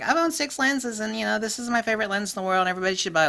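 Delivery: high, fast mock of a smug newbie; mock lens fan. Mocking a know-it-all customer in a high gushing voice.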